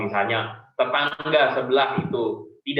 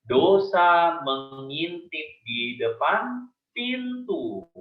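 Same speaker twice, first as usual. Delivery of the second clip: solemn scripture quote; 5 semitones higher, 3 dB louder, much slower than usual. Retold or imitated. Solemn quote of God's word.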